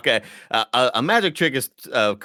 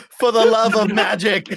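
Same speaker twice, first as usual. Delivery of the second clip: high, loud, hammed-up plea; mock-dramatic cry; 6 semitones higher, 5 dB louder than usual. Riffing on the magician's protest, mock-dramatic. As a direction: declaim it grandly for laughs.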